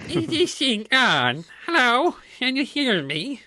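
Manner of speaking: put-on high, slow creaky old granny; Granny Smith voice. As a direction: croak it out like an old farm granny. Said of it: "your best Granny Smith voice".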